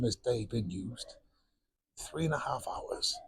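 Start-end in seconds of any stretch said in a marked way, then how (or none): 2.0-3.1 hushed emphasis. Acted. Stressing the driver's long day.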